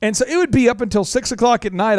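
ordinary delivery

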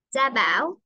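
usual speaking voice

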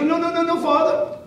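put-on protesting little boy. Boy reassures the suspicious priest.

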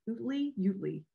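"Utley, Utley" as OOT-lee, then YOOT-lee; trying out pronunciations. Shown as a demonstration. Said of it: "don't ever know how to pronounce".

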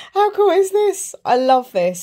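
high, loud gush of delight; thrilled, drawn-out high exclamation; excited. Real, not acted. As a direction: show it off with big enthusiasm. Showing off her t-shirt, delighted.

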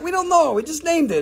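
high, lightly mocking vendor voice; a mocking imitation of a seller. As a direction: play the shrugging seller up high. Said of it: "And they're like".

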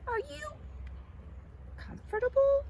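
very high, singsong question; high-pitched friendly check-in; calling out. A narrator's reading. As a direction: call it up high with a pause mid-question. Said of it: "cried Cornelius to Clara".

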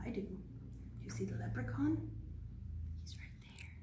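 soft secret whisper. Real, not acted. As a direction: whisper so the leprechaun won't hear. Whispering about hidden leprechaun.

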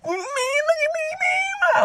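very high, strained voice; hammed-up desperate whine; high panicked plea. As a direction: plead in a squeaky mock panic.

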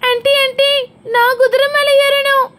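high-pitched pretend child voice; eager child. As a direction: call to auntie as an excited kid. Child begs for horse ride.

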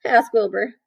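ordinary delivery

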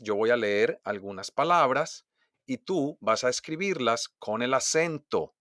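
ordinary delivery

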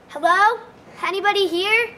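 loud call into an empty room. A narrator's reading. Jack calling into the empty diner, loud. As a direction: call out to see if anyone's there. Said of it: "he asked".

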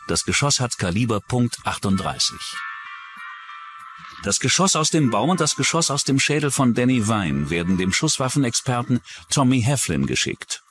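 ordinary talk

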